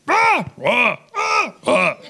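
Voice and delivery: short high wordless shouts; hammed-up bursts of argument noise; mock arguing yells. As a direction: trade comic barks like a squabbling couple. Imitating the couple mid-argument.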